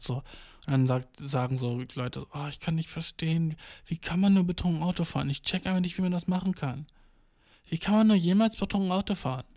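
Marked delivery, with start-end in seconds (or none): 2.3-9.4 soft, raised-pitch imitation of appalled people; mock dismay. People reacting to drunk-driving news, mock appalled. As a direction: lament it quietly in a higher voice.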